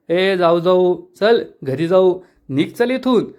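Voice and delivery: ordinary delivery, nothing marked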